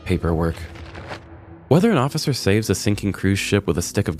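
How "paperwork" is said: ordinary delivery, nothing marked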